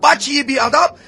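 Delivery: indignant scolding. Acted. Baker's defender berating a rude boy, high indignant scolding.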